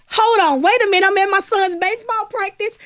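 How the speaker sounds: high and loud, bursting with joy; excited disbelief. Retold or imitated. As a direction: shout it out like you can't believe the news. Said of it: "And I was like".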